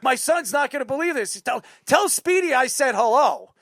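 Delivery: ordinary delivery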